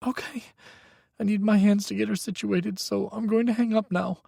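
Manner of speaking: put-on high, tense young voice; a frightened young man pleading on the phone; young man, scared. A narrator's reading. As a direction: Micah telling Hawk his ex is dangerous, high and scared. Micah's voice.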